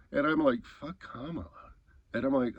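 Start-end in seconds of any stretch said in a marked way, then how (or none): 0.6-1.5 much softer repeat, under the voice; quiet incredulous echo. Retold or imitated. Repeating his words back to him, quiet disbelief.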